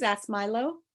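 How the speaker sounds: usual speaking voice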